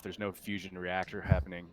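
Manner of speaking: ordinary talk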